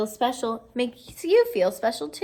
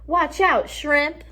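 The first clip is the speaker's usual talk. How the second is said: high, loud taunt down the hallway; a bully jeering at him; mock hallway yell; 6 semitones higher, 4 dB louder, slower than usual. A narrator's reading. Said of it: "some guy yells".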